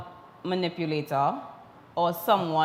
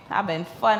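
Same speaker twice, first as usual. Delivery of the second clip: ordinary delivery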